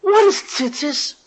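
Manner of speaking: high scornful "Tzitzis?" then a slow question; a touch of a wife's mock scorn; scoffing disbelief. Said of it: "So the woman says".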